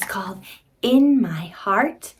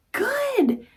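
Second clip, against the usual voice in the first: praise; 13 semitones higher than usual. Real, not acted. Praising child.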